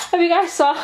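giggling through the question; laughing. Real, not acted. Vlogger asking her viewers about a funny video, laughing. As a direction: laugh it out as you ask.

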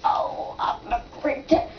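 put-on faint, whispery old lady. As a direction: breathe it out like a frail granny. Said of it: "we're gonna pretend to be old ladies".

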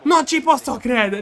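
high, loud screaming joy; real goal-celebration yelling; ecstatic shouting. Real, not acted. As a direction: lose it completely over the winner. Late winning goal celebration.

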